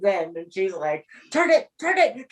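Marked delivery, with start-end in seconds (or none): 1.3-2.3 high, urgent mock panic; freaking-out mother, imitated. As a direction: imitate a mother frantically begging for the channel change. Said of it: "My mother will freak out".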